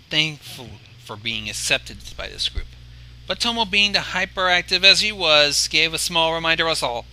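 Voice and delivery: plain reading voice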